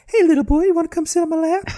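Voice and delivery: put-on very high, fast creepy old man voice; creepy old neighbor. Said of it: "the old man from Family Guy".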